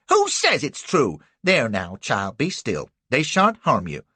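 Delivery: loud, high motherly defiance; protective old lady. Acted. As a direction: shield the girl fiercely.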